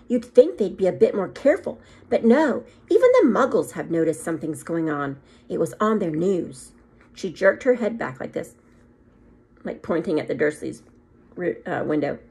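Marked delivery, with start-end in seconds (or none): 0.1-6.7 a stern headmistress, put out; stern witch. Acted. McGonagall voice, high.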